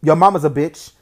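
a brother yelling an insult back; loud insult. Acted. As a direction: yell it right in his face.

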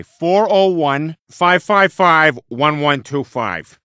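each digit drawn out loud for a poor English speaker; over-careful, slow phone-number delivery; slow, loud dictation. Acted. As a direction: spell out the number slowly and loudly. Dictating number to non-fluent listener.

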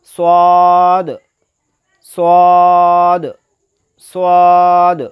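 সদ full-mouthed, loud and long held; thick heavy s. Shown as a demonstration. Teacher models the letter for learners, thick loud সদ. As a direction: stretch সদ with a heavy whistling s. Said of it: "মোটাভাবে আদায় হবে".

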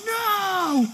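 high, loud falling scream of no; Vinny screaming as he falls; shout. Acted. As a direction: scream as you're pushed off the tracks. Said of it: "shouted Vinny".

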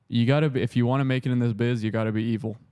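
normal talking voice

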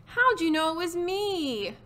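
high, drawn-out turkey groan. Acted. Disguised Turkey found out by Pig, plaintive groan. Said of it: "groaned Turkey".

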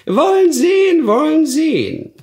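very high, loud witch voice; crackly witch repeating herself; croaking old hag. Acted. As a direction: cackle the words like a sly crone. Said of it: "krächzender Stimme".

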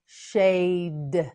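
"Shade" with long vowel, released d; slow modelling. Shown as a demonstration. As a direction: draw out "Shade" slowly. Teacher models word slowly.

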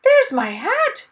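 high, loud, slow exclamation; delighted cry. Acted. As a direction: cry out in relief. Finds his hat.

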